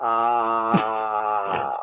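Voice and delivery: slow, deep villain cackle; evil laugh. Acted. Spooky podcast intro villain laugh.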